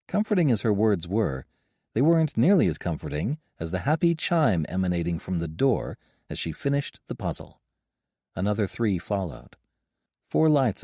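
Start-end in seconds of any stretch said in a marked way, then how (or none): none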